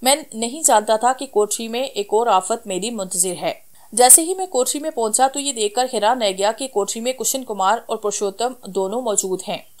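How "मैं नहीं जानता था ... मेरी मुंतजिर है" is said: usual speaking voice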